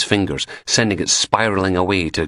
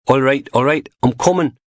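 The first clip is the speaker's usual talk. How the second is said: louder, higher impatient call; 7 semitones higher, 3 dB louder than usual. Acted. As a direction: hurry to the door, irritated. Man answering the door.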